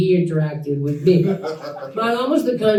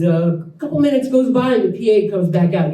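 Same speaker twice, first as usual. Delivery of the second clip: ordinary talk, nothing marked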